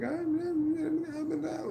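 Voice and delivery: very high-pitched imitation of tearful babbling; mock crying. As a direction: imitate a girl bawling, way up high. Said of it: "she started crying".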